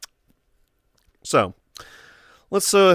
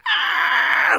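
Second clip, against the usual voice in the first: inhuman raccoon screech. Retold or imitated. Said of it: "making this inhuman noise".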